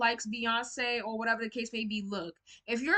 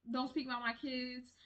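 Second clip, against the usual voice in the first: softly voiced, half-hearted Southern impression; imitating a Southern mother; 9 dB quieter than usual. Star mother warning the public off her kids, soft imitation. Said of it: "but you know how she sound".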